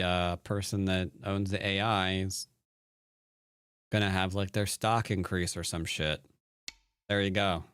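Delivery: ordinary talk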